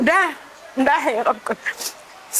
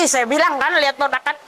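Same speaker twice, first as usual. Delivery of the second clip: ordinary delivery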